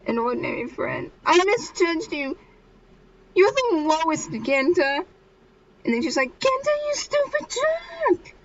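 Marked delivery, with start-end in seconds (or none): 0.0-5.0 acted high-pitched hurt voice; tearful reproach. Hurt girl reproaches the boy in tears, acted high reproach. 6.4-8.4 very high-pitched mock wail; crying outburst. Girl crying in the street at Genta, mock wail. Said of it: "on the middle of the street crying".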